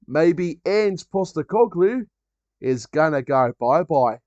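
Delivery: put-on Ange Postecoglou's accent. Mocking the Tottenham manager's likely sacking in an Australian accent. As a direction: drawl it like the Australian manager, teasing. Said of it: "I'll never get tired of that accent".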